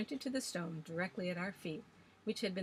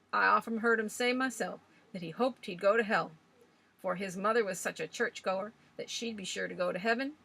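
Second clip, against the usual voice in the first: put-on old Swales ranting; 3 semitones higher, 7 dB louder than usual. A narrator's reading. Old man scoffing at legends to the young ladies, loud and gruff. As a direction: rail against ghost stories like a stubborn old sailor. Swales tells Georgie's story.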